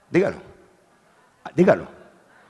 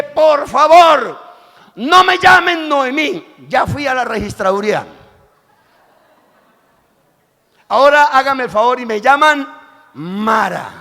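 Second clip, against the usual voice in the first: bitter Noemí; 7 semitones higher, 8 dB louder than usual. Acted. Noemí telling the women to call her Mara, high loud plea. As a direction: beg them to drop the old name. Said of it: "Y Noemí dice".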